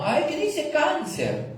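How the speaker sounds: high, slow, fussy put-on voice of a horoscope fan; mock horoscope reader. Preacher mocking a believer reading her horoscope, high silly voice. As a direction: squeal it out like someone thrilled by her star sign.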